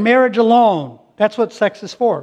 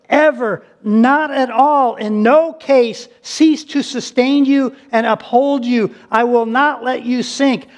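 preaching at full force; 4 semitones higher, 5 dB louder than usual. Acted. Pastor proclaims God's promise to his church, full force. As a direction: thunder the promise over the congregation.

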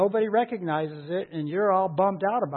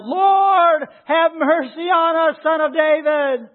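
loud, high shout for mercy in a scripture reading; crying out; 10 semitones higher, 9 dB louder, slower than usual. A narrator's reading. Blind men calling to Jesus as he passes, crying out. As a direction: call out at full voice, pleading. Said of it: "they cried out".

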